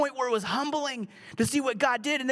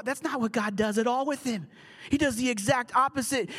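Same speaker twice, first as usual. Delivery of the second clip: normal talking voice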